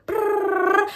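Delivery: a rolled prrrr mimicking rapid speech; mock fast-talk rattle. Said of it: "these people speak so fast".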